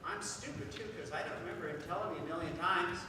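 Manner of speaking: put-on small, self-blaming kid voice; quick, hushed self-reproach of a child; hurt little boy. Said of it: "And so Johnny goes".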